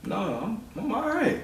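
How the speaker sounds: very high, soft reassurance; retold sweet-voiced comforting of a child; soothing denial. Father reassuring daughter he isn't mad.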